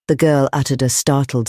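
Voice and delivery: ordinary delivery, nothing marked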